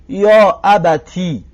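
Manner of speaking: «یا ابتی» slow, with "ti" on kasra; kasra on ta demo. Shown as a demonstration. Teacher modelling the kasra form, slow. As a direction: stretch «یا ابتی», ending in a clear "ti". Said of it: "کسرش بتیم".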